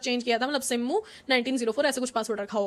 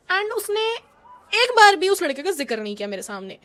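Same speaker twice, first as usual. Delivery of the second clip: usual speaking voice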